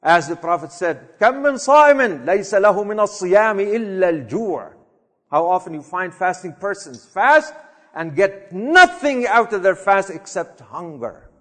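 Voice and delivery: normal talking voice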